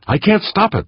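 high, contained yell; yelling back. A narrator's reading. Skipper to crew chief as tank drifts off, yelling back. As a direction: cry back in helpless protest. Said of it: "the skipper yelled back".

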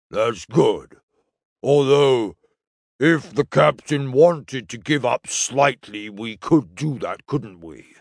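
slow, ponderous alien character voice; relieved alien crewman. Acted. The Henkayan reasons with Punjo, slow and relieved. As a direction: think aloud haltingly, reassured.

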